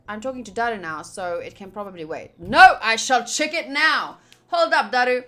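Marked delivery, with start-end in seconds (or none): none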